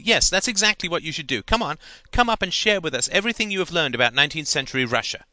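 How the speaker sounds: high, loud teacher egging a pupil on; loud sarcastic goading. A narrator's reading. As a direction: goad him in front of the class. Angry teacher orders pupil to front.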